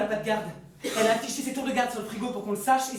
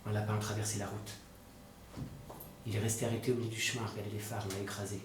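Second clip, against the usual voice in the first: low, soft monotone recollection; stunned, numb; 10 semitones lower, 7 dB quieter than usual. Acted. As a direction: recount it haltingly, in shock, with long pauses. Stunned brother recounts what he saw. (récit sous le choc, voix basse et éteinte)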